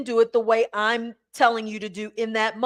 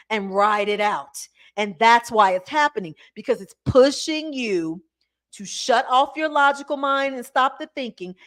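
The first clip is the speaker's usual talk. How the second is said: ordinary talk, nothing marked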